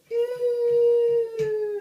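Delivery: long, loud, very high ghost wail; drawn-out spooky falsetto hoot; ghostly "ooh". Acted. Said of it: "the ghost of the queen is all like".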